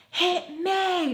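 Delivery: high, drawn-out mock scream; retold screaming. Friend threatens a punch, imitated high scream. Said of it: "just kept screaming at each other".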